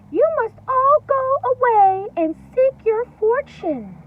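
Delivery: high, loud bossy mother pig. Acted. Mother pig telling her piglets to leave, high and loud. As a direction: send the piglets off like a firm mother.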